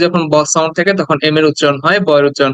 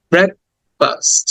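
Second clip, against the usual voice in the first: "Breakfast" slowly split as brek... fast; slow two-part model of "breakfast"; syllable by syllable; much slower than usual. Shown as a demonstration. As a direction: pause in the middle of "breakfast". Teacher breaks the word in two.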